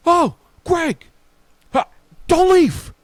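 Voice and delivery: high, loud retold holler; hammed-up cry across a deli; excited calling out. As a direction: imitate him urgently stopping someone. Neighbor calls out in deli.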